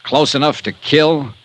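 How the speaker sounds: high, punchy promo for the next episode; a showman hooking listeners for tomorrow; radio teaser read. Acted. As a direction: tease tomorrow's show with relish and a wink. Star reads tomorrow's teaser.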